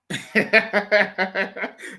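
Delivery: scornful laughter; mocking laugh. Real, not acted. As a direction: laugh at them.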